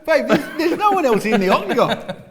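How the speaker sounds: high, loud laughing tease. Real, not acted. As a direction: laugh the words out at him. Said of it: "laughing teasing".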